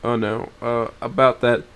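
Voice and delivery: usual speaking voice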